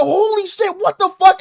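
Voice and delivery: high-pitched burst of disbelief; shocked reaction. Retold or imitated. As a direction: replay the stunned outburst, building in volume. Replaying own shocked reaction.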